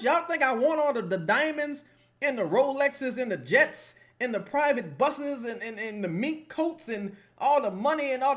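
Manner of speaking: hammed-up big spender pleading his case; mock indignant rich man. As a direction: whine like a spoiled millionaire. Mock rich [name] defending himself.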